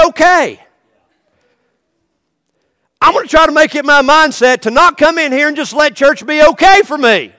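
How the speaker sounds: loud, high, urgent preaching; full-force preaching. Acted. Preacher urging the church not to settle, full-force preaching. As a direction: drive it home to the congregation.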